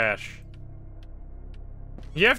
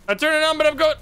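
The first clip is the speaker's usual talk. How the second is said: panicked; 12 semitones higher, 6 dB louder, faster than usual. Real, not acted. Fleeing monster, high loud.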